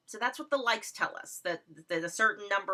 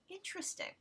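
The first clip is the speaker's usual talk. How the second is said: high, much softer musing; a quietly skeptical interesting; wry interesting; 9 semitones higher, 14 dB quieter than usual. Retold or imitated. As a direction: let it hang, skeptical. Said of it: "And I'm like".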